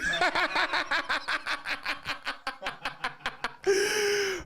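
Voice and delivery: long high-pitched helpless giggle; cracking up. Real, not acted. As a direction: lose your straight face and keep laughing. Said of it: "couldn't even keep a straight face".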